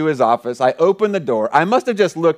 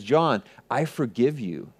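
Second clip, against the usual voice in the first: slow, soft, lowered voice; tender forgiveness; 4 semitones lower, 7 dB quieter than usual. Retold or imitated. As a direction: gently release someone from guilt, unhurried. Friend's forgiveness quoted again.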